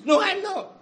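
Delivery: high, loud retold denial; emphatic denial. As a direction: act out Peter protesting, pitch jumping up. Acted denial by Peter under questioning.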